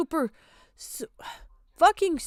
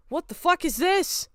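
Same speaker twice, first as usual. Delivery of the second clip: incredulous mockery; 3 semitones higher, 6 dB louder than usual. Real, not acted. Mocking the art's big ears.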